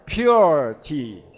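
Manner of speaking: "Pure" said pyoor, with the y glide, firm and loud; loud clear "Pure tea" with the y sound; pure, not poor. Shown as a demonstration. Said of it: "say it like a pure tea".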